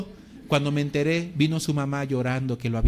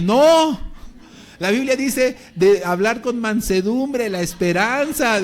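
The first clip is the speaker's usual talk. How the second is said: much higher and louder, slow and stern; firm rebuke; 7 semitones higher, 8 dB louder, slower than usual. Retold or imitated. Pastor forbids a beating for the persecutors, loud slow stern rebuke. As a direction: forbid it with full force.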